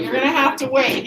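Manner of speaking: usual speaking voice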